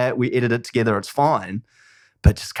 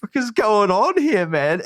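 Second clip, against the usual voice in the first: shocked disbelief; 9 semitones higher, slower than usual. Retold or imitated. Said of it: "And we were all like".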